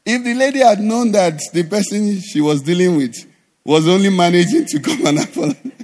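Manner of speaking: ordinary talk, nothing marked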